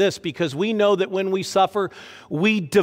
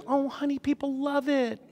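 high, softer doting wife voice; imitating wife Lisa; 8 semitones higher, 7 dB quieter than usual. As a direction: mimic a wife soothing her husband, higher and softer. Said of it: "She always goes".

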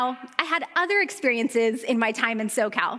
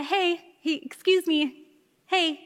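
lightly mimed polite little calls, higher; a hint of trying to get noticed politely; timid call for attention; 5 semitones higher, slower than usual. To coffee shop barista, mildly mimed timid bid for attention. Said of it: "You can't just do, like, a".

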